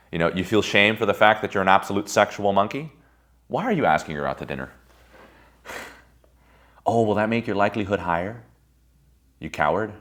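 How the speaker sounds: ordinary talk, nothing marked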